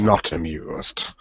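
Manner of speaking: mock regal disdain. Repeating the royal verdict.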